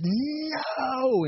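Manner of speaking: high, long drawn-out no; mock yell. Angry stranger leans right back in, mock yelling. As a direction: imitate an enraged yell, stretching the word. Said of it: "like, yells and comes right back up".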